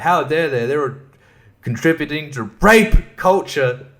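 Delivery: normal talking voice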